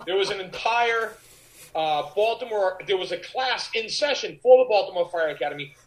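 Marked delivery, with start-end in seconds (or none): none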